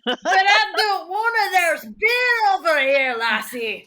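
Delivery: high, drawn-out character whine; reluctant Crouton. Pretend. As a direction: protest like a stubborn drinker. GM as Crouton, refusing to come.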